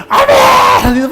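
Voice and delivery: mimicked screamed amen, very high and drawn out; hammed-up shriek of fervour, held long; congregation's amen shout. Young people answering the worship leader, exaggerated high drawn-out shout. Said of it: "또 앉아있는 청년들이".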